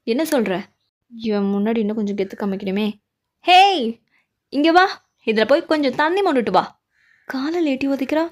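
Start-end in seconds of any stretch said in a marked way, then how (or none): 3.5-6.6 put-on loud, bossy call to a kid; bossy shout. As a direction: yell the order across the yard. சிறுவனை அதட்டி ஏவுதல். (சிறுவனை அதட்டி உரக்க கூப்பிடுதல்)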